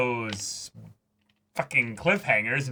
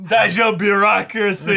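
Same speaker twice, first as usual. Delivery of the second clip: playful shout; 7 semitones higher, 8 dB louder than usual. Real, not acted. Heated banter about cliches.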